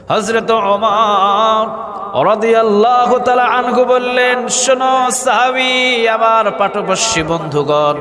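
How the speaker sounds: high, drawn-out chanting delivery; melodic preaching. A narrator's reading. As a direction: intone the holy name, stretching it long. (সুর করে টেনে টেনে ওয়াজ)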